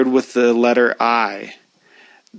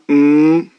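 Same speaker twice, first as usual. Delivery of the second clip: hummed mm through the nose, a bit louder; nasal hum; 3 semitones higher, 4 dB louder than usual. Shown as a demonstration. Teacher humming mm to show nasal sound. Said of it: "if we make a M sound".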